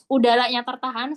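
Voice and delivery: ordinary talk, nothing marked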